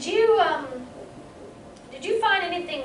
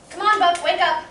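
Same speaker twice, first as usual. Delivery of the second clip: louder, coaxing call to wake up; calling him awake; 5 dB louder than usual. Acted. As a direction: rouse a sleeping man with a firm call. Waking a sleeper.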